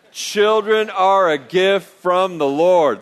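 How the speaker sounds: slow, loud, deliberate recitation; leading group recitation. Shown as a demonstration. Pastor leading both campuses together, slow emphatic recitation. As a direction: lead a crowd through it word by word. Leads congregation repeating it together.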